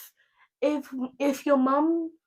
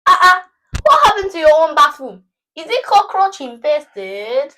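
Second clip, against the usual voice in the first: loud, indignant Nigerian accent; outraged mom in a Nigerian accent; typical Nigerian accent; 5 semitones higher, 14 dB louder than usual. Shown as a demonstration. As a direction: play it up in a strong Nigerian accent. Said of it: "typical Nigerian accent".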